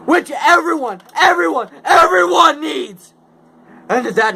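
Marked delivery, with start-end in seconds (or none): 0.1-3.1 high, loud shout hammering everyone; angry yell. Acted. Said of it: "I yelled at the Lorax".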